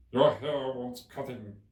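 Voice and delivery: drawn out and loud like the Hatter. A narrator's reading. Hatter remarking on Alice's hair, slow and loud. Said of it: "said the Hatter".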